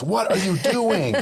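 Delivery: high, slow mock yell with a laugh; half-laughing imitation of a parent yelling; mock scream. Parent mock-screams in a child's face, half laughing. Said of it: "scream in their face".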